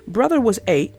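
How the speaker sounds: ordinary delivery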